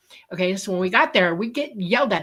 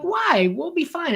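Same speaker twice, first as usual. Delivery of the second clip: carefree coworkers; 10 semitones higher, slower than usual. Retold or imitated. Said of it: "And they're like".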